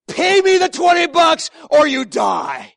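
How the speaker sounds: loud, hammed-up demand for the money; mock threat. Pastor mocking an unforgiving man's threat, hammed-up shout. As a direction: play the petty creditor, half-shouting.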